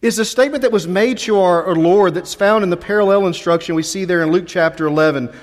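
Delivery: ordinary talk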